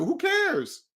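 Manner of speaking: high-pitched, dismissive question. Real, not acted. Brushing off a debt slave's opinion of you, dismissive.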